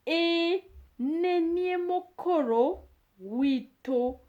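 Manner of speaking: E nenie mokoro wito said slowly, NEnie lifted high; slow model phrase. Shown as a demonstration. Teacher to learners, slow lifted model of the yes answer. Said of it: "teacher models yes answer".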